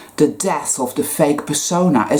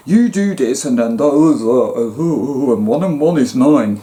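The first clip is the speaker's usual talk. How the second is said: mock know-it-all; 2 semitones lower than usual. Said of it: "the way you've been told".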